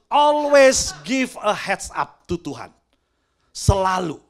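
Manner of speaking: preacher driving home the lesson; emphatic shout. Real, not acted. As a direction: hammer the moral slowly and loudly.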